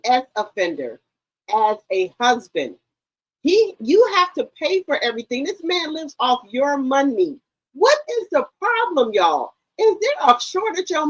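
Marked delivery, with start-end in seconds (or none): none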